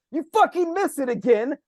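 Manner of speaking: mock furious yell, pitched high; yelling coach. Somebody else coaching after a miss, mock yelling. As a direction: chew out the player in a high, hammed-up shout. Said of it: "It's like Buff yelling at you".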